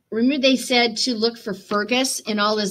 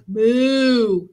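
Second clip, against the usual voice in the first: cow moo; 3 semitones higher, 4 dB louder than usual. Acted. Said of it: "said Cow".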